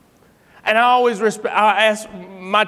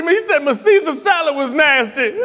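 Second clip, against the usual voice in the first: imitated crying; 7 semitones higher than usual. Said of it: "He is crying".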